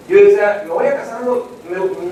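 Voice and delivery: ordinary talk, nothing marked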